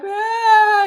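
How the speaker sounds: put-on a sudden high loud crying wail like a small child; imitated child's wail. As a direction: wail out loud like a toddler who can't say why. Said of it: "they're like".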